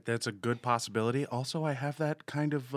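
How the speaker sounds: ordinary delivery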